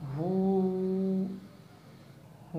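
हू held long; long vowel in waqf; madd held one second. Shown as a demonstration. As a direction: stretch हू for a second. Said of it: "वक्फ की सूरत में".

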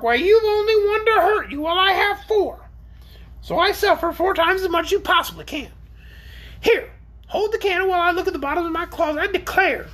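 shrill, indignant creature rant; crying out. A narrator's reading. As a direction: complain in a piercing, very high orc voice. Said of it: "cried the orc".

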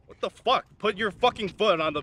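loud cursing frustration; exasperated yell. Acted. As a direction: blow up at them. Exasperated yelling at rider.

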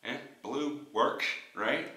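speaking around a mouth guard; talking with mouthguard in. Real, not acted. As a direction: teeth clenched on guard. Seen 0: Blue mouthguard seen between teeth at +0.1 and +1.1.

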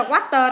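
"watch tờ" with the ED sounded as t plus schwa. Shown as a demonstration. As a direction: say "watch tờ", adding a little "tuh" at the end. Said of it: "là chữ tờ coi".

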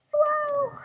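put-on very high, loud child's hello; high child call. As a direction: call out like a little bunny. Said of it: "called one leaping down the stairs".

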